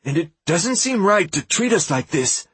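nervous protest, voice wavering; a scared boy forcing it out; shaky voice. A narrator's reading. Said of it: "trying poorly to steady his voice".

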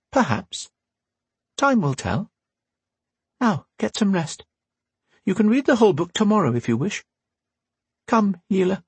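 a hint of a wise old teacher; Windy's voice. A narrator's reading. Windy dismisses them.

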